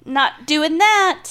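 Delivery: high, slow, firm refusal; emphatic no. Real, not acted. Friend refusing three-hundred-dollar swim, high slow emphatic no. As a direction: stretch it out, no way.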